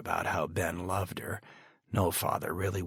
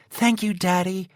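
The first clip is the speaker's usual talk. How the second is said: put-on high, girlish and grateful; a teenage girl's happy thanks; young daughter voice; 11 semitones higher, 8 dB louder than usual.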